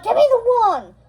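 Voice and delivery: high, loud snapping order; villain mother loses patience, shouting for the wand; furious demand. Acted. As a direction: grab for it with your voice.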